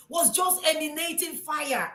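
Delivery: normal talking voice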